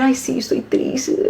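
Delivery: slow, soft, hammed-up sigh of sorrow; mock sad sigh. Mocking a melancholic child who says she is sad, slow and soft. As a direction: drag it out softly like a sulking saint.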